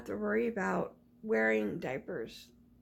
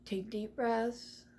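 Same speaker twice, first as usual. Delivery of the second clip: slow soothing instruction; 3 semitones higher, much slower than usual. Retold or imitated. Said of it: "They're like".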